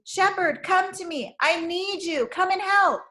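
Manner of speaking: high calling out for help; calling loudly. A narrator's reading. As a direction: call out to the shepherd from afar. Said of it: "She lifted her voice and called loudly".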